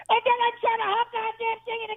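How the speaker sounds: put-on high-pitched crying old aunt voice; high aunt wail. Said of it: "he'd go".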